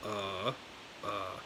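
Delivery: "uh" dropped low and soft; soft, low-pitched "uh"; low third tone. Shown as a demonstration. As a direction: sink "uh" to the bottom of the voice. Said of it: "the third tone for Mandarin".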